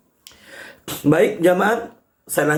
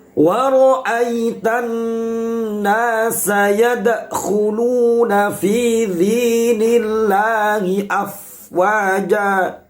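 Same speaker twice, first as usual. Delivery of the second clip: slow, drawn-out chanted Quran recitation; 4 semitones higher, much slower than usual. Shown as a demonstration. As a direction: recite the verse slowly, melodically. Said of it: "Saya lanjut ayat yang kedua". (tilawah Al-Quran yang lambat dan berirama)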